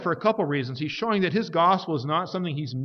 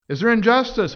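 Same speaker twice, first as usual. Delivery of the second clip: emphatic denial; 7 semitones higher, 6 dB louder than usual. Acted. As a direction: slam the door on the objection. Preacher answers objection emphatically.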